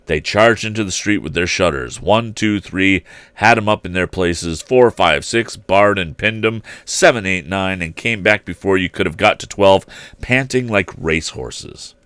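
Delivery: ordinary delivery, nothing marked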